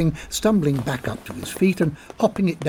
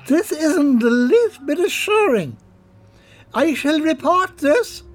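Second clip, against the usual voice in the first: very high, slow nervous calling; startled drunk; 11 semitones higher than usual. Acted. Colm calling into a dark alley, high and nervous.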